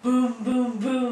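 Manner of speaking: big loud booms; loud booming. Acted. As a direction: boom it out loudly. Sound book, booming noises.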